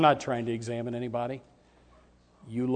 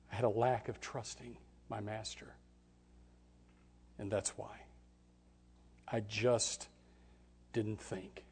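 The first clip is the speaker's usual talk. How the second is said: softer, sorrowful admission; father's confession; 6 dB quieter than usual. Acted. Leper father explaining his sin to his daughter, quiet. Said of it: "he would have to say".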